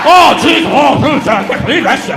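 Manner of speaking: loud, prissy mock gasp; mock the shocked audience member; mock groaning audience. Comic mocking the groaning crowd, loud prissy gasp. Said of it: "Stop groaning".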